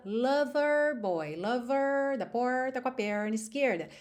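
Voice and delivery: "lover" with a curled American R; American accent, roll the R in "lover"; American-style "lover boy". Shown as a demonstration. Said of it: "No inglês americano".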